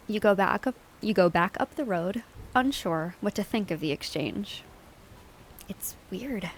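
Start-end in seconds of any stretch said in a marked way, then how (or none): 5.7-6.5 breathy hushed whisper; soft unvoiced whisper. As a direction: whisper it to yourself. Whisper in the game scene.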